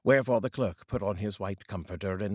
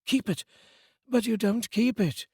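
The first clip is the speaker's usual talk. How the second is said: put-on high, louder incredulous young voice; nephew protesting; 13 semitones higher, 4 dB louder than usual. A narrator's reading. The nephew protesting to his uncle, high and incredulous. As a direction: push back at him, high and bright.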